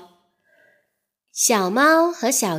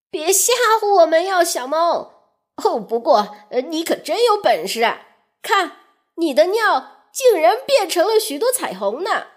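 fish leader; 6 semitones higher, faster than usual. Acted. Flying fish leader speaks.

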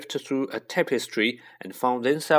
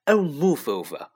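mildly irritated snarl; impatient, exasperated order; 2 semitones higher than usual. A narrator's reading. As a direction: snap it with mild annoyance. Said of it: "Hermione snarled".

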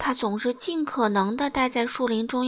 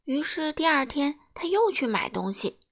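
usual speaking voice

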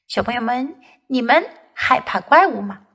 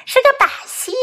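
put-on high, playful child voice; gleeful teasing; 12 semitones higher, much faster than usual. Kipper telling Mom it was a trick, gleeful teasing. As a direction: crow over the prank without a full laugh. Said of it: "齐波大笑起来".

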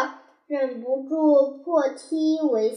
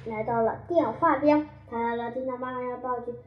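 plain reading voice

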